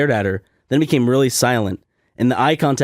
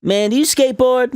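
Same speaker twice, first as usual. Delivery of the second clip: high, strained question; imitated oddball; 9 semitones higher than usual. Woman quizzing him about skateboarding, high mock voice. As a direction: put on the odd woman's high voice.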